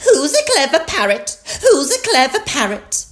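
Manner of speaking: high, loud parrot chatter; Polly Parrot. A narrator's reading. Polly recites at the supermarket, high parrot voice. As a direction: repeat it like a trained bird. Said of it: "Polly Parrot opened her beak and said".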